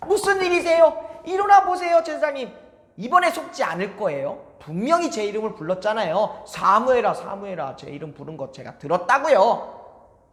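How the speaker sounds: put-on eager boy rushing to the priest; young Samuel. 사무엘 목소리. (높고 큰 어린 사무엘 목소리)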